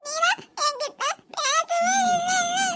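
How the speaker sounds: high, slow tearful sulk; a hurt little girl pushing him away; sulking crying child. Acted. Niece sulks tearfully at her uncle.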